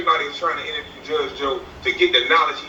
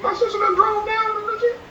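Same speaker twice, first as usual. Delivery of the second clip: high falsetto surprise; high-pitched mock astonishment. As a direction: squeal like you think it's your sister. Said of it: "I be like".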